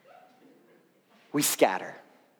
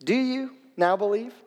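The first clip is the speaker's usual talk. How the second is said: lightly ironic, higher-pitched question; snarky Jesus; 5 semitones higher than usual. Acted. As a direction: question their belief with a touch of snark. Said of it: "Jesus is being snarky here".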